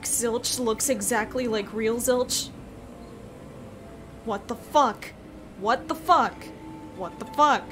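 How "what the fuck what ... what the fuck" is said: normal talking voice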